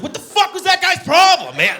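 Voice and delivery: high, very loud indignant shout; outraged deer. Acted. Wounded deer about the hunter, outraged. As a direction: yell it in hammed-up outrage. Deer reacting to being shot.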